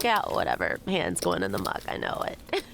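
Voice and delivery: low mock-bored mutter; a low, shrugging imitation of himself; dismissive bluffer. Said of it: "I'm like".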